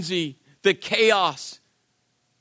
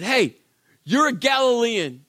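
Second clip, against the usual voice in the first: acted accuser with raised, higher voice; loud mock accusation; calling out; 4 semitones higher, 5 dB louder than usual. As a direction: call someone out in front of a crowd.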